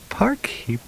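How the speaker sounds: breathy delighted whisper; whispered amusement. Acted. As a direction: savour the word in a whisper. Cheryl amused at word.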